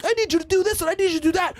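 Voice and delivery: high, fast mocking whine of a demanding girlfriend; mock nagging girlfriend. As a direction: rattle it off like a nagging partner. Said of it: "in the middle like".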